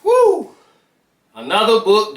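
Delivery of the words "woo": high, loud celebratory whoop; happy whoop. Real, not acted. Vlogger cheering after finishing the book, high whoop.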